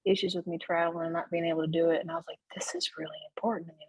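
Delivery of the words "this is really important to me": fast, hushed whisper. Retold or imitated. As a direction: breathe it out urgently in a whisper. Her inner plea to be baptized.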